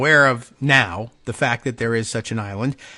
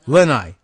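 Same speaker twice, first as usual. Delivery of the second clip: "Lenai" louder and falling, as LEN-eye; first-syllable stress; 5 dB louder than usual. Shown as a demonstration. Host testing a pronunciation, "Lenai" stressed on the first syllable. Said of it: "the stress is on the first syllable".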